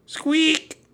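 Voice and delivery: very high, loud peep; piercing little squeak; mouse squeak. A narrator's reading.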